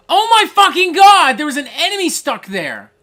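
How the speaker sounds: loud, high exasperated yell; angry outburst. Real, not acted. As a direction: shout it in outraged disbelief. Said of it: "I am so angry".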